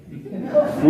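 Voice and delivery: short breathy giggle; amused little laugh; brief high chuckle; quick high laugh. Real, not acted. Laughs at the summons.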